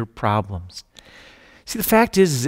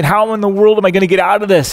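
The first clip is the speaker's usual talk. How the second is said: panicked thought; 5 semitones higher, 8 dB louder, faster than usual. Acted. Climber panics thinking on cliff.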